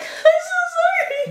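slow, very high tearful whine; mock bawling. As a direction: sob the apology out like a little kid, hammed-up.

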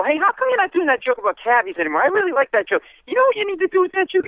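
the bar's regular hooker coaching a comic, eager; pushy hooker. Retold or imitated. Hooker at the bar giving joke notes, high and pushy. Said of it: "She'd go".

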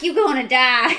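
hammed-up deep Southern medium's accent; deep southern Louisiana. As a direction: imitate a Louisiana fortune teller, loudly. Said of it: "deep southern Louisiana".

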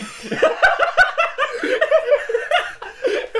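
high, loud helpless laugh; loud burst of laughter. Real, not acted. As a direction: crack up at the shock.

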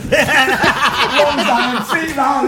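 slow words through laughter; laughing. Retold or imitated. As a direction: laugh out the quote. Laughing while quoting.

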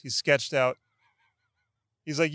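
ordinary talk, nothing marked

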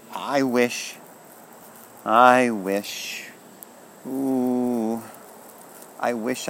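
put-on high, breath-held child's wish, repeated eagerly; excited wishing. A narrator's reading. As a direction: draw out the first wishes, then let them tumble out.

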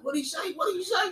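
high, hammed-up teacher asking what he said; mock teacher demand. Teacher confronting the kid after the insult, mock outraged high voice. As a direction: squeak it out like a teacher who can't believe his ears.

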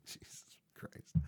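slow, whispered mock dismay; whispered disbelief. Retelling reaction to mom's odd word, slow stunned whisper. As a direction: whisper it under your breath, retelling your disbelief. Retold reaction to mother's offer.

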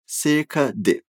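ordinary delivery

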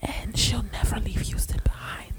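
breathy ASMR whisper, unvoiced. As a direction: whisper close to the mic like an ASMR video. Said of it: "A S M R review".

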